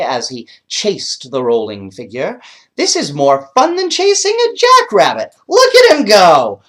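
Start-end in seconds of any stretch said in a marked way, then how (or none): 2.8-6.6 high, loud happy collie voice; high loud cartoon dog, teasing; excited dog. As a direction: bounce it like a dog who loves hikes. Dog chasing rolling boy.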